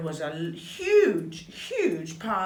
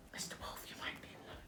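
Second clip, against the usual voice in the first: conspiratorial whisper; 19 dB quieter, much faster than usual. Acted. Neighbours plotting for pancakes, whispering. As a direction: whisper it so the wolf can't hear.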